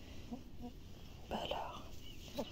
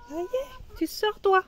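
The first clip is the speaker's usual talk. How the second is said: high coaxing; 8 semitones higher, 4 dB quieter than usual. Real, not acted. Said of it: "high coaxing to hen".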